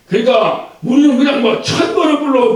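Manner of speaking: ordinary talk, nothing marked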